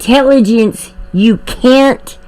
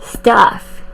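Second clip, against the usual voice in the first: normal talking voice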